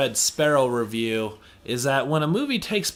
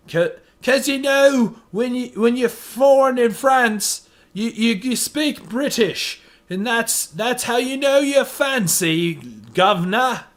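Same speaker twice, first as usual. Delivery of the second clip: hammed-up fancy British accent, higher; mock posh British accent; 7 semitones higher, 5 dB louder than usual. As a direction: play a snooty Brit, mocking the casting. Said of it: "Everyone had British accents".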